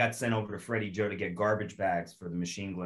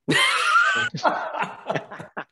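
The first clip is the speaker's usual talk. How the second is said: burst of laughter; 7 dB louder than usual. Real, not acted. Sergeant's joke lands.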